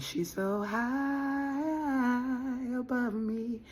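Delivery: high, very slow swooning voice; mock lovesick crooning; dreamy admirer. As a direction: sigh it out like you're smitten. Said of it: "Now I'm hearing that".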